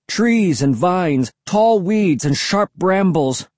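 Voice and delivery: ordinary delivery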